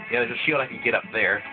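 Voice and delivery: normal talking voice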